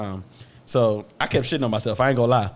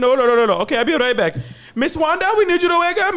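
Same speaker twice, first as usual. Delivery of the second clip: high, louder mock nurse voice calling out; imitating the nurse; 14 semitones higher, 5 dB louder than usual. Nurse waking another patient, high loud mock call. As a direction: call across the ward like the nurse.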